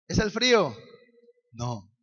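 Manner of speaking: normal talking voice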